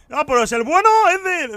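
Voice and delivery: high, loud chirpy mock customer. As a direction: ask like an eager stranger. Customer voiced again. (imitando a un cliente curioso, agudo y alegre)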